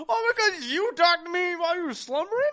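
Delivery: high, loud, half-crying laughter; laughing mock indignation. Mocking his wife for talking in her sleep, laughing. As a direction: sputter it through laughing sobs.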